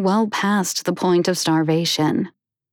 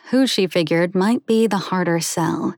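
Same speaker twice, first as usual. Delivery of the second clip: ordinary delivery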